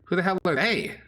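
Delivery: a guard challenging strangers; high loud challenge; suspicious guard. Acted. Necromancer challenging the party.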